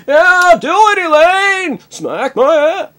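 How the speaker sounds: very high, loud, slow squealing; hammed-up sex cries. Jerry cries out to Elaine during sex, hammed-up. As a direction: squeal it out in mock ecstasy. Imagined Seinfeld sex scene.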